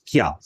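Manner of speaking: क्या as one short falling syllable; one breath stroke. Shown as a demonstration. Teacher demonstrating one syllable to students, क्या in one stroke. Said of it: "श्वास के एक आघात में उच्चारण हुआ".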